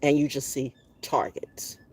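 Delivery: whispered, slow and ominous; unvoiced low whisper of menace. Real, not acted. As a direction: drop to a slow chilling whisper.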